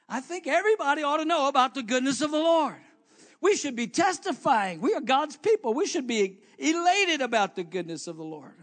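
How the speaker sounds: high, loud preaching. Acted. Preacher urging the congregation to testify. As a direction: rouse the church.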